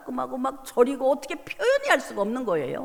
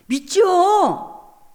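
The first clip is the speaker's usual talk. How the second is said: answer; 4 semitones higher, 5 dB louder, much slower than usual. Retold or imitated. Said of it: "그랬더니".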